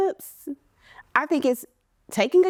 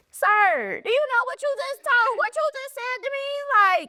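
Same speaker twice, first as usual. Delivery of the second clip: much higher, sassy, put-upon voice; indignant scolding; 13 semitones higher than usual. Retold or imitated. A woman scolding a man who hurt her feelings, high and indignant. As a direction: call him out like he crossed a line.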